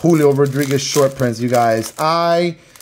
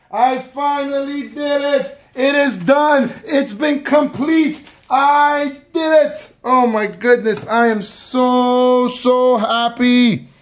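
overjoyed; 9 semitones higher than usual. Real, not acted. Collector tells viewers he finally pulled it, high and overjoyed. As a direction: gush with real joy.

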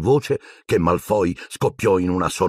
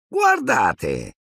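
a touch higher, eager look-here; gleeful call; 4 semitones higher than usual. Acted. Malfoy calls the class to look, gleeful. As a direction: call everyone over with glee.

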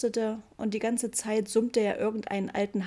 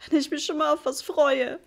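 higher, louder sobbing complaint; 6 semitones higher, 4 dB louder than usual. A narrator's reading. Mother sobbing over the ruined wallpaper, sobbing. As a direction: sob it out, voice breaking. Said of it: "schluchzte sie".

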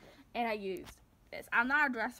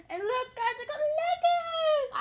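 excited; 9 dB louder, slower than usual. Real, not acted. Showing off new Legos to viewers.

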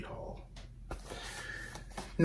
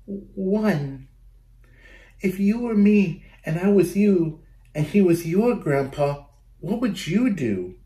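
put-on little girl Sophia; 8 semitones higher than usual. Sophia voiced in a story.

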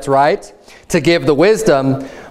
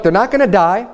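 normal talking voice

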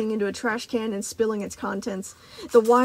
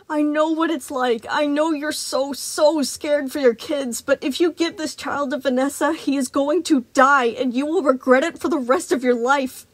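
urgent plea; 4 semitones higher, 4 dB louder than usual. Acted. Freddy begging Moon.

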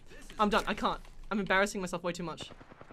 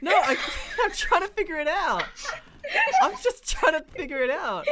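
laughing protest; 7 semitones higher, 5 dB louder than usual. Real, not acted. Protesting while friends laugh at them.

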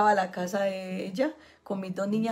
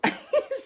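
high laugh. Real, not acted. Laughs at santo esposo.

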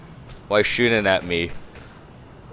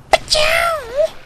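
high, squeaky mock sneeze; fake sneeze; 5 dB louder than usual. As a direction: sneeze out loud in a silly way. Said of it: "and I'm like".

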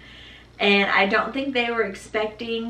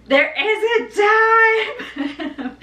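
much higher and louder warning; urgent warning; 13 semitones higher, 5 dB louder than usual. Retold or imitated. Mother recalling telling her husband the baby is coming. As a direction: sound the alarm that it's time. Urgent announcement, high, loud.

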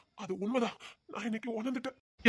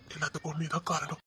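whispered promise; 4 semitones lower, 6 dB quieter than usual. Acted. Tender promise to pregnant wife.

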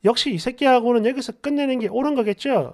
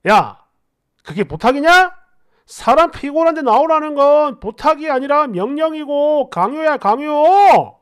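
raised voice, indignant, last word stretched loud; angry yelling; 4 dB louder than usual. Acted. Boyfriend rejecting her complaint, yelling back angrily. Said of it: "고함을 버럭버럭 질러요".

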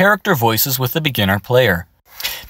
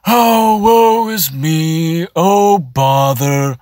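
slow, airy sighing voice; mopey sigh of self-pity; breathy; 5 semitones higher, 3 dB louder, much slower than usual. Shown as a demonstration. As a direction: let lots of air out, slowly. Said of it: "Or really breathy".

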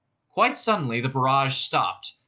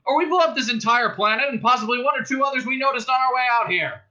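high, raised cop speech; shouted ultimatum; 9 semitones higher, 4 dB louder than usual. A narrator's reading. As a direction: proclaim the terms across the room. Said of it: "shouted the cop".

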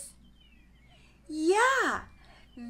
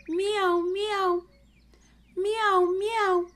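high meowing like a cat; 8 semitones higher, slower than usual. Shown as a demonstration. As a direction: meow like a kitty. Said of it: "A cat says".

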